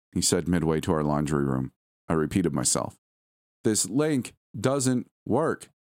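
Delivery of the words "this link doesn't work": each word spaced out, higher pitch; slow, emphatic repetition. Acted. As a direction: repeat it very slowly, word by word. Repeating herself to distracted husband.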